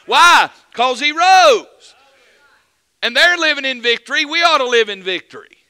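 loud, high, fervent proclaiming; a revival preacher in full cry; full-force preaching. Acted. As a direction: thunder the victory at the congregation.

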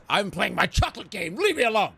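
high, whiny mock outburst; hammed-up, strained high tantrum; weird CEO. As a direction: shoo everyone off like a petulant boss. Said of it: "I don't wanna be the weird C E O guy".

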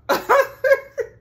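loud shrieking laugh; real helpless laughter; big high laugh. Real, not acted. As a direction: burst out in a high cackle. Reacting to "feel ecstatic.

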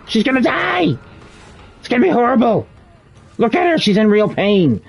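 high, hammed-up wailing alarm; mock panic. Killer pretends horror at her pain, mock panic.

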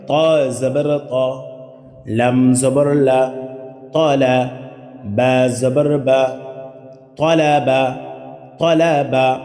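slow, sing-song syllable spelling; slow spelling drill. Shown as a demonstration. Teacher demonstrates spelling for the class, slow chant. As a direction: spell each letter slowly, then read the word. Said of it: "কোনো টেনে পড়া যাবে না".

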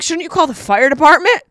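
high, loud disbelieving question; incredulous yell. Retold or imitated. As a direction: shout it back, baffled. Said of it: "I'm like".

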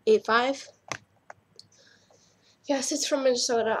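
normal talking voice

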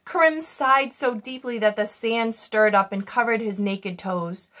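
ordinary delivery

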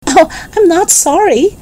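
high, lilting amusement without a laugh; amused lady. A narrator's reading. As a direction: read it high, as if tickled. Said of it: "said the lady, laughing".

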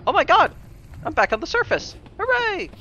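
high, loud joyful cheer; relieved cheering. Real, not acted. Escaping back to surface.

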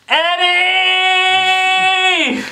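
very high, loud, drawn-out scream; scream to the sky. Acted. Player's character screams up into the snowstorm, desperate skyward scream. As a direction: wail the name skyward at full volume. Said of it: "I look up to the sky and I scream".

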